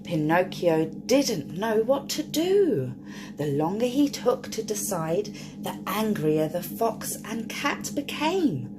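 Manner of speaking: ordinary delivery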